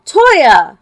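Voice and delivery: screamed name. Acted. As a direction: scream his name in alarm. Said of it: "a scream of".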